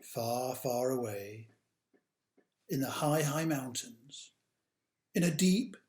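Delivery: put-on slow, dramatic read-aloud for children; storybook narration. A narrator's reading. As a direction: tell it like a bedtime tale, big and grand.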